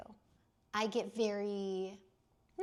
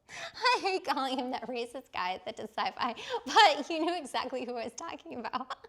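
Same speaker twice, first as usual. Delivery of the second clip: talking through giggles, higher and louder; laughing; 5 semitones higher, 8 dB louder than usual. Real, not acted. Streamer embarrassed at her own description, laughing. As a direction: laugh through the words helplessly. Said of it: "laugh cry".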